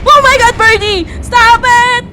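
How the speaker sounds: loud, high frightened plea; high shout of alarm; panicked yelling. Real, not acted. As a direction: yell at it to back off. Begs bird to stop.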